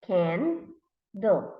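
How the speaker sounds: "can" and "do" as two isolated beats; read by syllables. Shown as a demonstration. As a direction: pause between "can" and "do". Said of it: "read by syllables".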